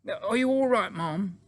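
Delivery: high-pitched, worried maid; anxious, high-voiced servant; alarmed maid. Maid alarmed at shocked Queen.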